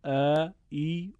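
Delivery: slow drawn-out ا، ای sounds; long vowel sounds. Shown as a demonstration. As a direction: stretch ا and ای slowly like a demo. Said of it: "یا".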